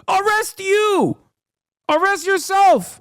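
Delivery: high, slow, put-on arresting voice; mock officer ordering arrest; hammed-up agent. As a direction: drag it out like a goofy cop.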